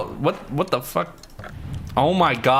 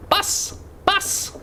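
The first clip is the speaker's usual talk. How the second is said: high cry. Acted. Shouting for the bus.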